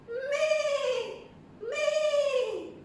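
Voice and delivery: very high, long-held bleat; goat bleat. Pretend. Teacher voicing a goat for young learners, high drawn-out bleat.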